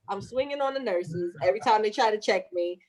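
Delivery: usual speaking voice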